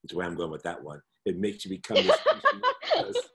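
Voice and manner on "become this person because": words spilling out through a high laugh. Real, not acted. Laughing to her hosts about being crazy, giggling through it. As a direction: laugh while you speak, pitch way up.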